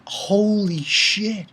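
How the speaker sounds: slow, soft disbelief; hushed shock. Retold or imitated. Reviewer recalling reading the chapter, hushed stunned swear. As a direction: let it out slowly under the breath.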